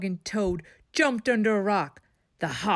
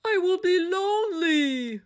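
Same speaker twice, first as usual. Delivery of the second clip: high, sorrowful plea; lonely plea; 11 semitones higher than usual. A narrator's reading. Toad pleads with vanished Frog, high and sorrowful. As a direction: let the fear of loneliness show.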